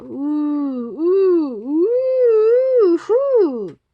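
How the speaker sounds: spooky wind howl, swelling and rising; long swooping ooohs, dying away. A narrator's reading. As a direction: hoot like wind in a dark forest. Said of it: "the wind began to blow".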